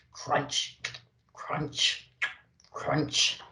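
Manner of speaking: low, soft crunching noises; quiet carrot-munching sounds. Acted. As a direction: crunch it slowly like chewing a carrot.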